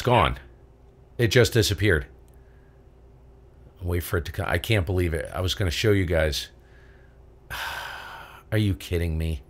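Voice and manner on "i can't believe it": ordinary talk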